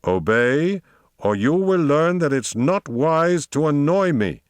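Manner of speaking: slow, high-pitched threat; menacing. A narrator's reading. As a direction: draw the warning out imperiously. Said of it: "tone full of dreadfully quiet menace".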